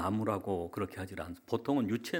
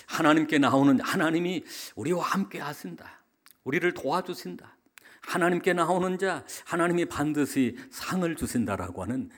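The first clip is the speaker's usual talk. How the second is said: raised, high, emphatic preaching declaration; 7 semitones higher, 6 dB louder than usual. Real, not acted. Pastor declaring God's help to the congregation, emphatic preaching. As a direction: proclaim it with force, voice lifted.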